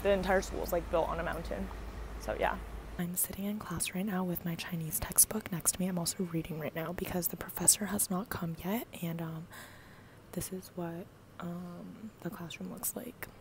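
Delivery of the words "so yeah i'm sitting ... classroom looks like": whisper. Real, not acted. Filming quietly in a classroom.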